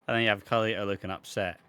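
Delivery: ordinary delivery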